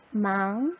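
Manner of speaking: "망" drawn out on a rising second tone. Shown as a demonstration. Teacher adds the tone for students, "망" in slow rising second tone. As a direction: lift the pitch steadily through "망". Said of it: "이성 넣어서".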